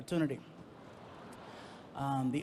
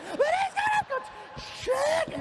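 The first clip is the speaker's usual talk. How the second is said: shrieking mock female wail; hysterical mom; 5 dB louder than usual. Girlfriend refusing to be soothed, comically hysterical shriek. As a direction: screech it at the very top of the voice, hammed up. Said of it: "his mom getting hysterical".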